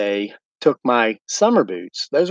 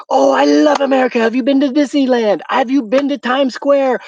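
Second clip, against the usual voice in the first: much higher and louder, gushing over America; gushing English fan; 13 semitones higher, 4 dB louder than usual. Retold or imitated. English local gushing to the American visitor, high and loud. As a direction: play the starstruck local thrilled to meet an American.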